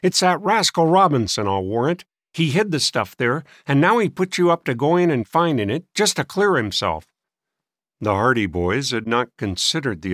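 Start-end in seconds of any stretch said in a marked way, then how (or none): 0.0-7.1 crotchety, higher-pitched accusing; testy old man. A narrator's reading. Old man accusing boys of a scheme, testy.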